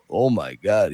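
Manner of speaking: low-pitched imitation of an awed movie star; Sly Stallone. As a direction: drop the voice low in amazement. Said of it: "When Sly saw it".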